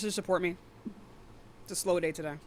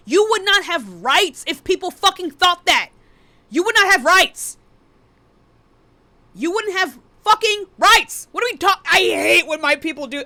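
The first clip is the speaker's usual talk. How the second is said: loud, high, outraged yell; real angry shouting; 9 semitones higher, 9 dB louder than usual. Real, not acted. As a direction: explode in frustration, hammering each word. Rant boils over into shouting.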